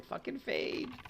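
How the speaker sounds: very high, softened mock yell; high-pitched parody of a panicked shout; meme catchphrase. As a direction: squeal the running joke at reduced volume. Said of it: "kinda memeing on it and saying".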